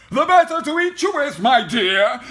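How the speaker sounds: fast, loud, high snarl; snarling wolf. A narrator's reading. Wolf about to pounce on Little Red, loud snarl. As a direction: snarl it out at full attack. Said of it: "snarled the wolf".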